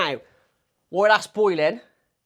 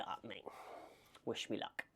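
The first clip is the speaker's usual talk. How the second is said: whisper; 8 semitones lower, 19 dB quieter than usual. Real, not acted. Muttered aside before a risky task.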